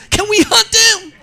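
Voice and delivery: high, slow hopeful ask in a put-on Louisiana accent, laughing; eager Louisiana in-law. Relative asking the host to hunt his geese, high eager Louisiana accent. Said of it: "He goes".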